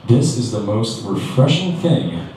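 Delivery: drawn out slowly, savoring it; mock blissful, unhurried; slow content musing. Owner enjoying the insults, slow relaxed delivery. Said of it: "I'm like".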